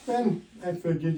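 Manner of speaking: ordinary delivery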